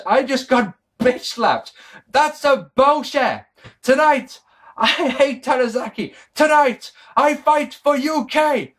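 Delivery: high, emphatic bad Japanese-accented English; Akira Tozawa, bad accent. Reviewer imitating a wrestler's angry promo to the crowd, terrible Japanese accent. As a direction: do a slow, indignant mic promo in a poor Japanese accent. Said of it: "That's not his accent. I'm sorry, I'm terrible with accents".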